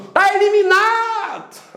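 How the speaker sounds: high, drawn-out mock announcement; exam proctor. Proctor disqualifying the shouting student, mock calling out. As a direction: call out the verdict, stretching the end. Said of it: "o cara que tá aplicando a prova".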